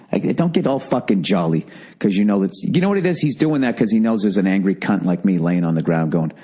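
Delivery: usual speaking voice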